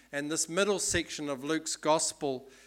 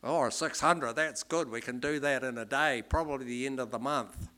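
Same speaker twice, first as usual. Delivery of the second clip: put-on Australian accent. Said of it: "this is my best Australian accent".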